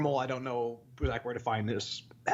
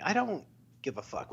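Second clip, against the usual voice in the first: ordinary talk, nothing marked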